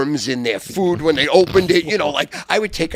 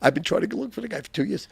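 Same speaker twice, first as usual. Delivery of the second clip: ordinary talk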